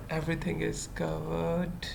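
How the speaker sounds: ordinary talk, nothing marked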